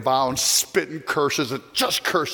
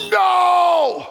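high loud shout of horror; anguished cry; 12 semitones higher, 6 dB louder than usual. Acted. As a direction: cry out as the blow falls. Servant watching execution.

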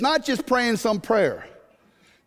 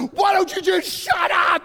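high, slow scolding shout; exasperated midwife; 8 semitones higher, slower than usual. Retold or imitated. Midwife scolds screaming husband.

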